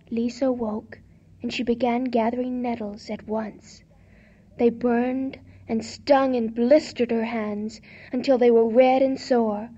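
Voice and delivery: ordinary delivery, nothing marked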